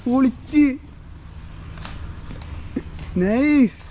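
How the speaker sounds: high, thrilled praise; excited cheering. Real, not acted. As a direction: hype the skater up. Cheering landed trick.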